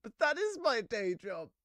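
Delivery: hammed-up wounded performer; hurt comedian's protest. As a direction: protest in a high, indignant character voice. Heckled joker protests in character.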